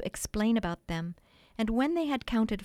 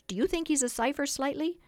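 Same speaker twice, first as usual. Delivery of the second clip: eager question; 6 semitones higher than usual. A narrator's reading. Boys eagerly polling each other.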